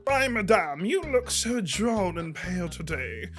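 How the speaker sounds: put-on genteel Austen-style British accent; discreetly rude, in put-on British politeness; mock British accent. Detested rival insulting the speaker in Austen novel, mock British accent. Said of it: "someone would be Britishly".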